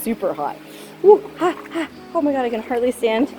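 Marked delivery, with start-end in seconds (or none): 1.0-1.9 yelp from hot water. Real, not acted. Stepping into scalding water.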